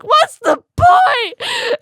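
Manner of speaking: high, loud, very slow laughing wail. Real, not acted. Friend giving up on the report, laughed out. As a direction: stretch it out in mock despair. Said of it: "She's like".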